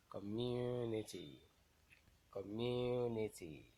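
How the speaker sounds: Community with MU stretched out; teacher's demo of com-MU-nity; stressed syllable. Shown as a demonstration. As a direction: slowly lean on MU in Community, then fall. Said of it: "the one that will be pronounced louder".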